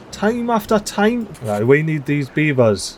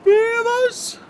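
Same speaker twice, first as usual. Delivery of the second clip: much higher sing-song "morning, beavers", slow welcome; cheery morning greeting; 10 semitones higher, much slower than usual. Acted.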